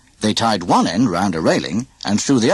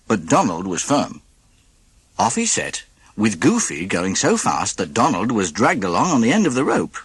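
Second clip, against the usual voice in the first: plain reading voice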